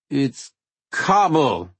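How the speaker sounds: put-on slow, drunk and insistent; tipsy insistence. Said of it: "I asserted".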